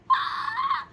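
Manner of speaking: very high laughing shriek; excited squeal. Real, not acted. Host squealing in excitement over the prize. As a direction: squeal with delight.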